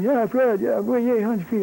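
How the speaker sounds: retold cheering. Classmates crowding round Fred after his win, excited cheering.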